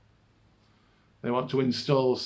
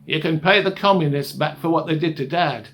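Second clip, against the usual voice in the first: louder, insistent recruiting pitch; urging brother; 3 semitones higher, 7 dB louder than usual. Retold or imitated. Brother recruiting the speaker to avenge Dad, loud urging. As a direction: press the case forcefully as the brother.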